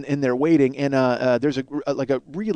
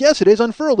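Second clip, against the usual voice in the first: higher, emphatic retold comeback; insistent retort; 7 semitones higher than usual. Teen arguing with friends over a word, insistent. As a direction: insist on it, pitched up.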